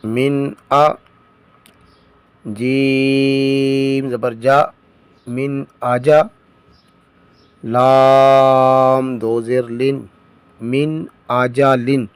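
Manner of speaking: slow, measured letter-by-letter recitation; slow spelling drill. Shown as a demonstration. Teacher drilling students in spelling, slow recitation. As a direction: chant each letter and vowel slowly.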